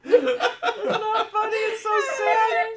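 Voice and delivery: very high, loud and slow, laughing to tears; laugh-crying. Real, not acted. Friend crying with laughter over the shaved cat. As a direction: wail the words through helpless laughter. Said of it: "I shouldn't laugh".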